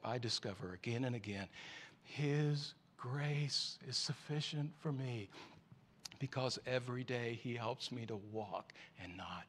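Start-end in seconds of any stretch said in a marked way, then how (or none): 2.0-9.4 slow, soft whisper of faith; hushed close. Real, not acted. Preacher ending the sermon in a near whisper. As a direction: breathe the last words.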